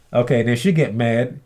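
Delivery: usual speaking voice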